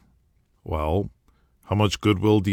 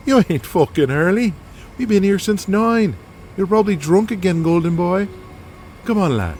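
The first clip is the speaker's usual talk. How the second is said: boisterous Irish wrestler; 11 semitones higher than usual. Acted. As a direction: rib him like a rowdy mate. Killian mocks Donnie.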